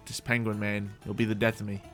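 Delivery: normal talking voice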